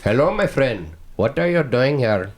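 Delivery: a mild hint of an Indian accent. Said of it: "Indian is more like".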